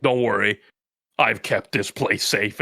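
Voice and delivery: deep, unhurried hero voice; low and reassuring, like a battle-scarred leader; stoic hero. Acted. Leader Ricky greets his returning pack, deep and stoic.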